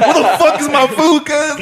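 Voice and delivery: high, slow, drawn-out complaint; mock dining rage. Hungry diner demanding his late food, mock outraged. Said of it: "I have dining rage".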